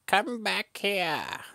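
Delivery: high, loud and slow cartoon bird call; ostrich voice. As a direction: stretch it out like a dim bird. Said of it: "the ostrich is still like".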